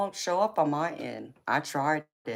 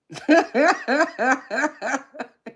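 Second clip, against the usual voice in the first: high, loud laughing burst; big laugh; 10 semitones higher, 8 dB louder than usual. Real, not acted. Reader laughing at her Aries joke, high and loud.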